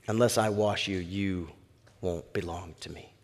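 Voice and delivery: very low, hushed rebuke; grave, soft warning; Jesus, quietly firm. Acted. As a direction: put him in his place softly, trailing almost to nothing. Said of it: "Jesus puts Peter in his place".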